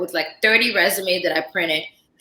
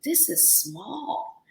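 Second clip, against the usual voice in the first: hushed aside; 5 semitones higher, 10 dB quieter, much slower than usual. Retold or imitated. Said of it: "I'm like".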